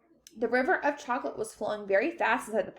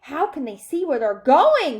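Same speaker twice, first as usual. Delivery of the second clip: high, loud alarmed cry; shriek; 5 semitones higher, 9 dB louder than usual. A narrator's reading. Violet in the dark tunnel, shrieking. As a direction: rise to a shriek. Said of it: "shrieked Violet Beauregarde in the darkness".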